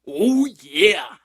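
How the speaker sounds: a loud, high mascot cry in a put-on voice; big Kool-Aid Man oh yeah. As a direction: blast it out like a cartoon mascot. Repeated catchphrase, second one big.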